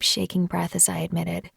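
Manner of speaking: ordinary delivery, nothing marked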